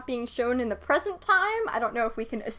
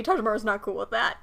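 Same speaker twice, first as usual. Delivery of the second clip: usual speaking voice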